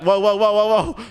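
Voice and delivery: high, loud rapid whoa; alarmed whoas. Real, not acted. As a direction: panic as the car swerves. Fleeing in the car.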